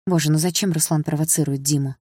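ordinary delivery

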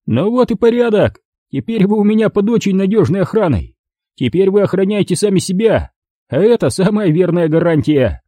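high, pleased thug voice; gloating thug. Acted. Thug gloats to his bound hostages in a high pleased voice. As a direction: gloat over the trapped pair.

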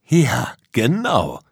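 slow, loud cry of agreement; exclaiming. Acted. Danonino confirms Tom has got it, exclaiming. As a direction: call it out, stretched and emphatic. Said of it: "rief Danonino".

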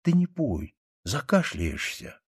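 timid, slowing plea; frightened. A narrator's reading. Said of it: "испуганно сказала девочка".

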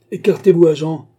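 loud, high-pitched angry command; shouted order. A narrator's reading. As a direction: shout the officer out of the way. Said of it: "cria mister Fogg". (ordre crié, fort et aigu)

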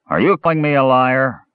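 put-on high-pitched drunken dwarf taking offence; tipsy challenge. A narrator's reading. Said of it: "a familiar voice that the half-elf suspected was dulled by ale".

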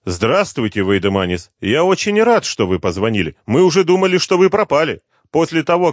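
high, loud, falsely polite gloating; mocking villain. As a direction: sneer through mock courtesy. Villain Hashimov on the phone.

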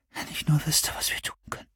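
sehr leise, verhauchend geflüstert; ratloses Flüstern. Acted. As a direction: ratlos ins Dunkel seufzen. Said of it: "Madonna seufzte ratlos". (Madonna flüstert ratlos)

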